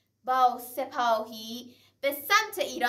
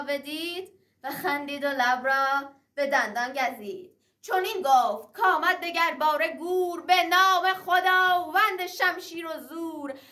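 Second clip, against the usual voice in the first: epic teahouse storyteller; 5 semitones higher than usual. Acted. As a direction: ring out the verse in triumph. Verse of Sohrab arming for battle. (نقالی حماسی بلند و پرطنین)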